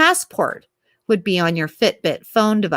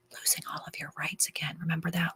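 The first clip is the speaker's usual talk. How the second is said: hushed, whispery aside; whispered aside; 16 dB quieter, faster than usual. Real, not acted. Reminding listeners of lost rights, whispered.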